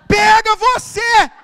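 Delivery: high, slow mock retort. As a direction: drag out "Pega você" high and slow, teasing. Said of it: "A vontade".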